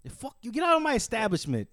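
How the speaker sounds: high-pitched New York accent, indignant; New Yorker. Shown as a demonstration. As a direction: throw them out in a mock New York accent. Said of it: "I could do a New Yorker".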